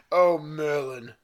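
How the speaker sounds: loud, drawn-out exclamation; exclaimed. Acted. Reader tries the whispered line too loudly, exclaimed. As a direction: cry it out slowly and too boldly for a whisper. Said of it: "it probably wouldn't be like that".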